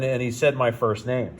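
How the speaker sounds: normal talking voice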